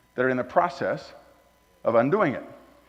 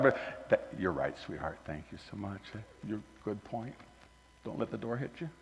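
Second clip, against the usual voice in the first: much softer mock-sweet brush-off; sarcastic dismissal; 6 semitones lower, 14 dB quieter than usual. Pastor dismissing a job applicant, soft and sarcastic. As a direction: sweetly show her out, dripping sarcasm.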